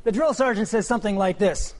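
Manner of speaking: normal talking voice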